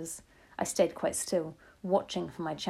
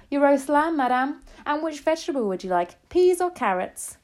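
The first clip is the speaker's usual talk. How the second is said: high, loud waiter's voice; waiter William; 8 semitones higher, 7 dB louder than usual. A narrator's reading. Waiter serving grandmother at dinner, high and projecting. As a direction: announce the dish in a raised, put-on voice.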